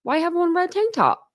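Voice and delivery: high, incredulous retold question; puzzled mock question. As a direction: toss it off as a baffled, teasing question. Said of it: "And Vincent came in and he's like".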